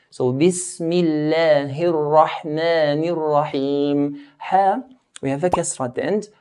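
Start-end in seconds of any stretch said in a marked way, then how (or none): 0.3-4.2 Bismillahir Rahmanir Rahim slowly, each i crisp; slow, clear kasras. Shown as a demonstration. Teacher recites slowly stressing every kasra. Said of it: "making the kasras clear".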